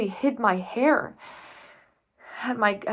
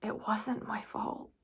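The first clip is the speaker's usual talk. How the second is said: much softer, as if to herself; quiet, private self-reassurance; hushed; 9 dB quieter than usual. Acted. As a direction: say it under your breath. Same words repeated after long pause.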